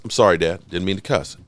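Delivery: quick hushed sorry for cussing; sheepish apology. Acted. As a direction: duck your head and apologize to Dad. Apologizing to dad for swearing.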